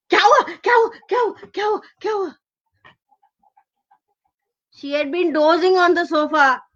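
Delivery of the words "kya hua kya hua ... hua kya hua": high yelping repeated question; startled panic. Acted. Grandmother woken by a shout, high startled panic. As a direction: jolt awake in a flustered volley.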